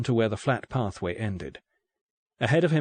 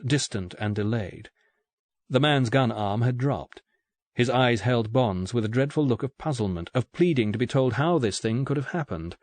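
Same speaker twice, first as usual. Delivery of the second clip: ordinary delivery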